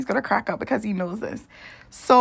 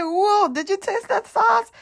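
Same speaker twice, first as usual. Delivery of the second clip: amazed friend; 12 semitones higher, slower than usual. Retold or imitated. Friend asking her about the hot sauce, amazed. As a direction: draw it out high like he's stunned.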